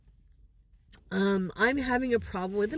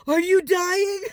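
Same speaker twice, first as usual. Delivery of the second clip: high, loud scared question; frightened shout; 7 semitones higher, 6 dB louder than usual. Retold or imitated. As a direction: cry out in alarm. Grandson fears she is dying.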